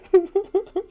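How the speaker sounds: cracking up at the clip; loud high laugh. Real, not acted. Streamer delighted by the dog clip, loud high laugh.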